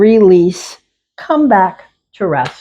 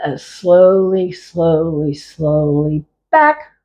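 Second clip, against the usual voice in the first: slowed right down, each word stretched; slow motion; slower than usual. Shown as a demonstration. Teacher slowing the class's moves down, slow and drawn out. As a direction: guide the class at a crawl. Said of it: "And again".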